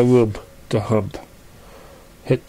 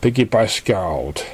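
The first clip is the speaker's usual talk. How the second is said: plain reading voice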